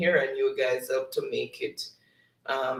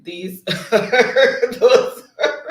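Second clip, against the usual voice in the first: laughing; 7 semitones higher, 8 dB louder, much slower than usual. Real, not acted. Host laughs reading Tiffany's comment.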